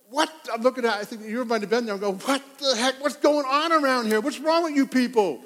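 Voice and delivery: pastor replaying his own shock at the volleyball prank; shocked outburst. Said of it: "And I'm going".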